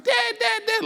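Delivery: high-pitched imitation of a child calling; insistent little-kid calls; excited kid. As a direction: mimic a kid pestering for attention. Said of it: "your kid's like".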